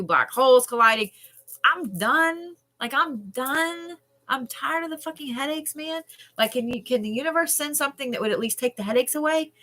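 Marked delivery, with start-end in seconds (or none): 1.6-9.5 high, pleading complaint; fed-up, high-voiced moan; exasperated whine. Real, not acted. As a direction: plead with the universe, pitched up. Complaining about endless headaches.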